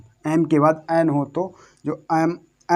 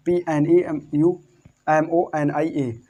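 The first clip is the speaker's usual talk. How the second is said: slow, separated letter names for the class; spelling out; slower than usual. Shown as a demonstration. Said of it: "मतलब कि इसको पढ़ेंगे सिर्फ pneumonia".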